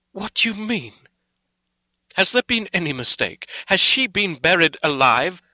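high, loud cry of anguish. A narrator's reading. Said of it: "He groaned in anguish".

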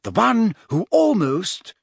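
"almost" drawn out with heavy stress; high voice, leaning hard and slowly on "almost"; emphasis on almost. A narrator's reading. Hermione needling Ron about the keeper post, stressing "almost". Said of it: "put a great deal of emphasis on the word".

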